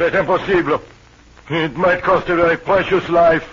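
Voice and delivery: high, loud, agitated protest; 1 agitated refusal. Acted. Accused man refuses his interrogators, high and agitated. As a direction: push back hard, voice up and loud.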